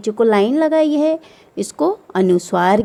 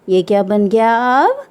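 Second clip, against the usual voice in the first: ordinary delivery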